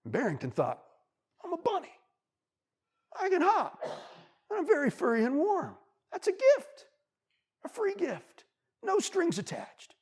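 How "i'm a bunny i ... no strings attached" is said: much higher, bright squeaky bunny voice; high happy bunny. Acted. Bunny repeats the wolf's lesson to himself, high and happy. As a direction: chirp it out with new pride.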